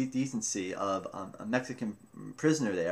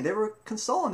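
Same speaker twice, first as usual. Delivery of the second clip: normal talking voice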